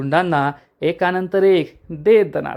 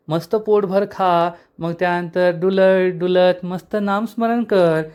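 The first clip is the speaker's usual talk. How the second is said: mocking villain; 3 semitones higher than usual. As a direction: talk down to the old man with fake concern. Villain mockingly soothes old brother.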